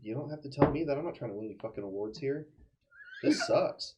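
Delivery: ordinary talk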